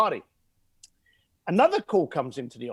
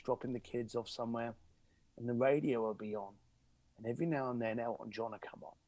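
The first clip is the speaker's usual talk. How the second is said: much lower and softer, moved; tender sad memory; 6 semitones lower, 11 dB quieter than usual. Real, not acted. Caller remembers his late dad, softly moved. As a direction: say it gently, holding emotion. Emotional memory of dead father.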